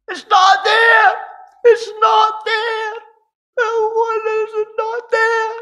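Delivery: put-on very high, loud, drawn-out child's wail; crying scream. Wee girl screaming at the Christmas scene, crying loudly. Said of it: "crying away and screaming".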